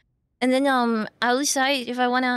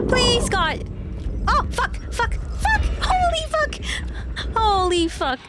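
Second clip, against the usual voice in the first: panicked swearing; 11 semitones higher than usual. Real, not acted. Panicked chase scene swearing.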